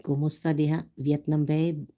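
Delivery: normal talking voice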